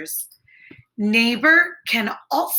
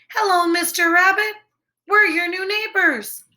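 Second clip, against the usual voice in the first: lightly voiced bright otters, higher; cheerful otter greeting; 6 semitones higher than usual. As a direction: greet the new neighbor brightly. Otters greet rabbit in story.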